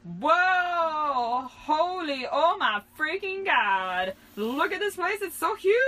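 high, loud screaming wonder; top-of-the-lungs shriek. A narrator's reading. Girl first seeing the huge venue, shrieking. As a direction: shriek in awe, building to a scream. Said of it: "I shrieked at the top of my lungs".

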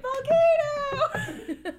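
high, drawn-out gleeful cry; exaggerated little-kid exclamation, laughing; child at play. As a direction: squeal it out like a kid playing in mud, stretched long. Voices child playing in mud.

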